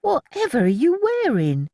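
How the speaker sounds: high astonished question; surprised. A narrator's reading. Annie startled by Milly's glamorous clothes, high surprise. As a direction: gasp at the outfit.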